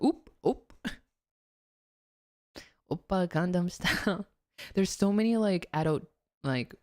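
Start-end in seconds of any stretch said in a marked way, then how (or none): 0.0-0.7 higher, louder chirpy "oop, oop"; mock a Midwesterner's little startled oops; playful imitation of a folksy Midwest exclamation; Midwestern oop. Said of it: "The people that go like".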